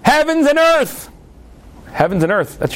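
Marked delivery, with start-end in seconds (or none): 0.0-1.1 calling out. Retold or imitated. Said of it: "he calls out".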